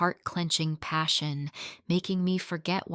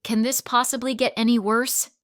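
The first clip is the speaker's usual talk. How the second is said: annoyed groan; 5 semitones higher, 4 dB louder than usual. Acted. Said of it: "Marcus groaned in annoyance".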